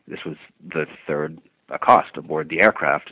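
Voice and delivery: usual speaking voice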